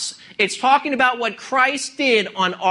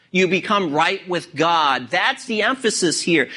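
ordinary talk

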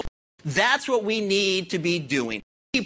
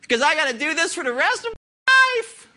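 loud, higher mock-wail of a whiny child; 7 semitones higher, 5 dB louder than usual. Preacher mimicking believers who wail about giving, loud mock wail. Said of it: "start wailing and wa-, wailing".

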